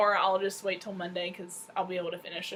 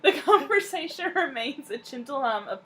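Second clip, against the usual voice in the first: higher, louder, giggles breaking in; reading through laughter; 4 semitones higher, 5 dB louder than usual. Real, not acted. Resuming the book blurb after a stumble, still laughing. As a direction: try to read on while laughing.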